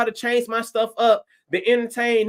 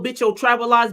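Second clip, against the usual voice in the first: usual speaking voice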